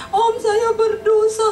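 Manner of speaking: high, slow, tearful plea; crying confession. Retold or imitated. Robber confessing to his uncle through tears, high and slow. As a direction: sob out a confession to your uncle. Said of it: "Nangis dia di rumah omnya".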